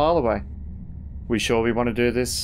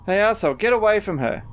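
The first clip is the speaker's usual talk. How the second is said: raised-pitch angry warning; angry confrontation; 6 semitones higher than usual. Acted. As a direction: call out to warn off an attacker. Confronting attacker to protect a friend.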